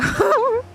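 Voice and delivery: high-pitched laugh. Real, not acted. Streamer conceding the mix-up, high laugh. Amused by sounding like bub.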